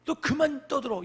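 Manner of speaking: a touch of an irritated crowd; scolding crowd. Retold or imitated. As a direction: lightly act the crowd telling him off. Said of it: "사람들이 이 바디메오에게".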